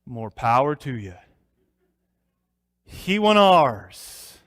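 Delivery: slow, deliberate country verdict with a loud "ours"; Briscoe's drawl. Retold or imitated. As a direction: draw it out like a puzzled mountain man. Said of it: "No one quite says it like Briscoe Darlin".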